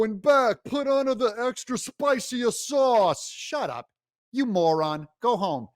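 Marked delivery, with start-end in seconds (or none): none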